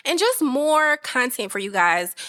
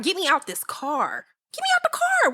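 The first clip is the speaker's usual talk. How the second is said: mock panic; 7 semitones higher than usual. Host joking she wants out, escalating high squeal. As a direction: jump to a squeaky high shriek.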